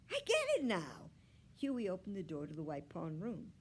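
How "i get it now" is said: high, loud exclamation; excited boy's raised voice; sudden realisation. A narrator's reading. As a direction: cry out as it dawns on you. Boy suddenly understands the dog.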